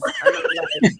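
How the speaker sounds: high, laughing squeal; laughing at the tiny raise. Real, not acted. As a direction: break into laughter mid-line. Laughing retelling boss raise.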